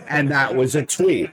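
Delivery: normal talking voice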